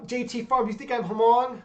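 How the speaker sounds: ordinary delivery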